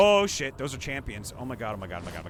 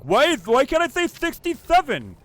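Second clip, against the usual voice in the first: higher, louder whine; whiny kid; 9 semitones higher, 8 dB louder than usual. Pretend. Kid complaining about a word ban, whiny.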